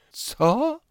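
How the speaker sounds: sharp, very high startled cry; frightened gasp. Acted. Mother startled by the shouted news, high frightened cry. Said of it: "przestraszyła się mecenasowa".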